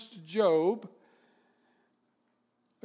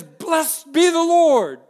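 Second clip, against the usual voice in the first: slow, high, loud proclamation; proclaiming; 8 semitones higher, 10 dB louder, much slower than usual. Acted. Preacher voicing David, slow loud proclamation. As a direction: ring it out like a herald. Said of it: "He's now proclaiming".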